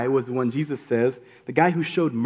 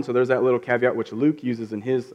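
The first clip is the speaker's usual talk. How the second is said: ordinary delivery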